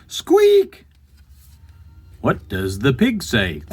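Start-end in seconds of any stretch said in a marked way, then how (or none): 0.1-0.8 very high, loud mouse squeak. Acted. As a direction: squeak shrilly like a tiny mouse. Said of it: "The little mouse says".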